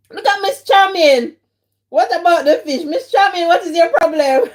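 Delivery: teasing a viewer, mock scolding; playful teasing. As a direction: call her out with a grin.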